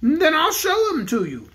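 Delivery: the Bear King addresses the cook; kindly Bear King. Acted. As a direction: speak as a gentle storybook king. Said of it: "promised the king".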